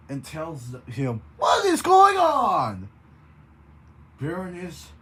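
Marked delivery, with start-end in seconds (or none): 1.4-2.9 loud, high demanding yell; shout. Acted. Cowboy breaks the door and yells at them. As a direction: burst in yelling.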